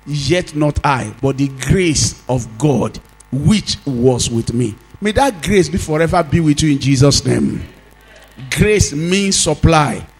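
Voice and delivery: emphatic preacher, stretching key words; forceful preaching. Real, not acted.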